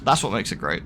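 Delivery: normal talking voice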